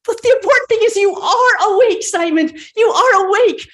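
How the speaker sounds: overjoyed. Acted. Margaret rejoicing that Simon is awake, excited. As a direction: bubble over with relief.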